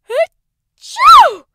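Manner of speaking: huge high achoo; explosive sneeze; loud sneeze. A narrator's reading. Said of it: "His mother sneezed".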